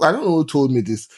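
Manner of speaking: ordinary delivery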